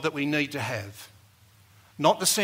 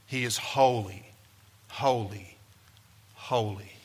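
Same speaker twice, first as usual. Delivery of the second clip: each "holy" set apart by a pause, pitched low; low, weighty "holy", three times, spaced out; underlining by repetition; 5 semitones lower than usual. Retold or imitated. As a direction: let every "holy" land separately, sinking lower. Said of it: "that repetition is meant to underline".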